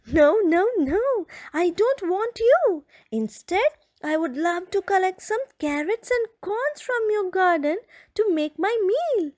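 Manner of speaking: a hint of high playful fox voice; lightly voiced cheerful fox; light giggly fox. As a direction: reassure her playfully as the fox. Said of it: "giggled the frisky fox".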